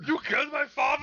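put-on loud Austrian-accented movie shout; Schwarzenegger impression. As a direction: bellow it like Arnold in an action film. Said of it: "The Schwarzenegger".

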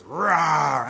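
put-on a playful little-kid rawr, loud; mock toddler roar. Imitating his two-year-old pretending to be a lion, loud mock roar. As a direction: growl it like a tiny lion. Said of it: "and she goes".

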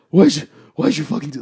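mock panting and panicked; out of breath. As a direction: imitate a winded, flustered cop. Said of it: "like, out of breath".

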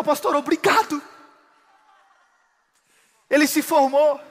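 full-force preaching. Acted. Pastor preaching a convert's testimony to the congregation, loud and high. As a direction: proclaim the testimony to the church.

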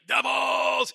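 booming super fan shout of Devils; hockey fan's yell. Retold or imitated. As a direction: yell it like a face-painted hockey fanatic. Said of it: "has this real deep stentorian voice".

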